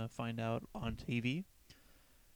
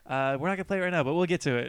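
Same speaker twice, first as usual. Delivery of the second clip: imitated polite frontman; 4 semitones higher, 8 dB louder, much faster than usual. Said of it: "he just became, like, this really polite guy".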